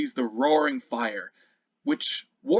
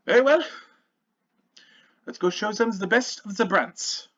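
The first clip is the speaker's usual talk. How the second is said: rallying father; 9 semitones higher, 4 dB louder than usual. Acted. Father rallies his son.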